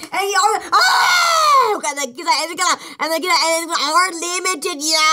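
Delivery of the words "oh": sudden high excited yelp; excited shout. Real, not acted. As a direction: cry out in sheer delight. Excited reaction to game win.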